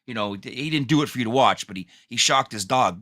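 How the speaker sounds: ordinary talk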